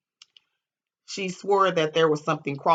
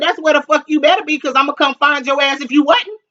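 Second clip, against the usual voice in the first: threatening; 11 semitones higher, 9 dB louder than usual. Retold or imitated. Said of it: "I said".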